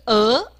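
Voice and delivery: 呃 as "uh", sweeping upward; 升调示范. Shown as a demonstration. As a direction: end 呃 on a steep rise. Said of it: "第三个是呃". (念“呃”，尾音上扬)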